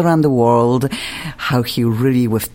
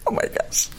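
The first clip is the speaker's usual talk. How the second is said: breathless whisper; 8 dB quieter than usual. Real, not acted. Swooning over the English accent.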